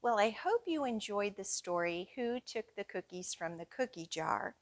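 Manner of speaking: ordinary delivery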